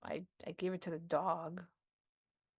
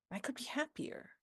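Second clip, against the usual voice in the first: softer hopeful repeat; 5 semitones higher, 7 dB quieter than usual. Acted.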